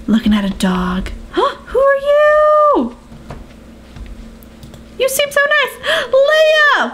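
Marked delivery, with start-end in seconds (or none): 1.3-6.9 excited squeal. Real, not acted. Excited meeting villagers.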